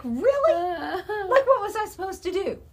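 high, squeaky, laughing complaint; mock whiny protest. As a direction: whine it like a wronged kid half laughing. Retold protest about the whipping.

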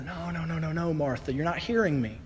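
soft, loving reassurance; gentle Jesus. Acted. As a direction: hush her grief gently. Jesus speaks gently to Martha.

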